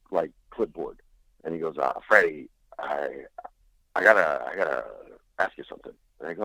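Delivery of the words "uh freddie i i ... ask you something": put-on lowered, hesitant voice of an older comic friend; lightly voiced older friend, low and halting. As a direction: stammer it out low and unsure. Friend's halting request retold.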